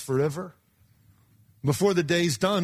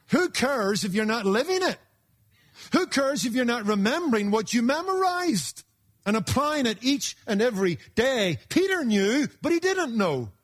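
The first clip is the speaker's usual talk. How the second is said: rising preaching; 7 semitones higher than usual. Real, not acted. Preacher rises to full force.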